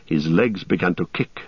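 usual speaking voice

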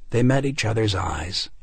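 plain reading voice

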